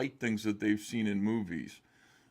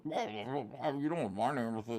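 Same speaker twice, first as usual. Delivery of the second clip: mock voice; 6 dB quieter than usual. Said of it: "fat people have a special voice".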